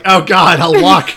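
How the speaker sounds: loud exaggerated cry of dread; mock panicked yell. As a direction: yell it like a scared passenger. Mock complaint about her driving.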